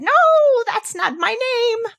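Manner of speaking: very high, slow taunting no; squeaky little man. Acted. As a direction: gloat over her wrong guess. Little man refuses names.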